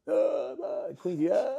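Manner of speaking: comic calling-out; mock yelling. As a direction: imitate hollering an order out. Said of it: "You don't have to yell".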